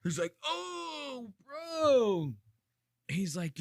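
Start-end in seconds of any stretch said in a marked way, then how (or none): 0.4-2.3 high, drawn-out mock wail; mock dazed groan. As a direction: imitate a stunned fighter wailing. Mimicking stunned fighter on ground.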